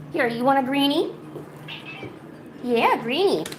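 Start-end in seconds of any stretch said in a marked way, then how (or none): none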